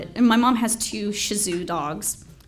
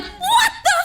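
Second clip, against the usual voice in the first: a squeaky, much higher cry of alarm; high startled "What the fuck?"; shocked disbelief; 4 dB louder than usual. Retold or imitated. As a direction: recoil as the box is pushed at you. Handed box with live rat.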